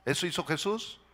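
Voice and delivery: ordinary delivery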